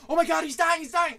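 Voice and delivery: put-on high, loud panicked kids; hammed-up panic, pitched right up; mock freak-out. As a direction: imitate frightened onlookers crying out. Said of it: "freaking out".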